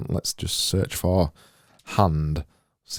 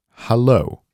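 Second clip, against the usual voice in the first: higher, louder assistant-style hello; Siri-like hello; 5 semitones higher, 6 dB louder than usual. Shown as a demonstration. Designer echoing his own Siri-sounding hello, higher and louder. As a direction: pitch it up like a voice assistant greeting. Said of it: "sounded like a Siri or something".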